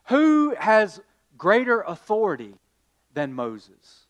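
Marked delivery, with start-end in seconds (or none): none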